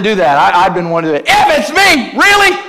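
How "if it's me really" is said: very high mock-outraged squawk; incredulous echo. Jesus echoing doubting Peter, loudly incredulous. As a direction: shout it back in hammed-up disbelief.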